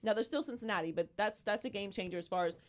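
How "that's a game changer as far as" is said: ordinary talk, nothing marked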